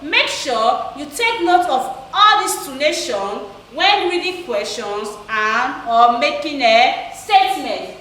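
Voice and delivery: ordinary talk, nothing marked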